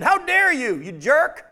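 loud, high, indignant outburst; mad. Acted. Paul got mad at the high priest, angry. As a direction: explode at him in acted outrage. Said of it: "Paul got mad and said".

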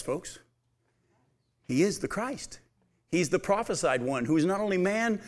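ordinary delivery

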